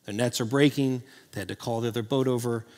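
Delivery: ordinary talk, nothing marked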